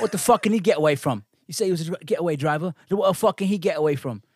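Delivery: high, indignant mock British rant; loud, incredulous British comic outburst; British accent. Co-host acts out British joke about getaway driver, mock British accent. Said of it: "Their fucking accent's the best".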